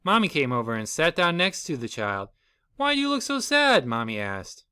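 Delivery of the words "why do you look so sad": mother. Acted. Mother voiced, concerned.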